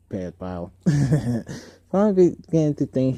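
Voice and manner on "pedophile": ordinary delivery, nothing marked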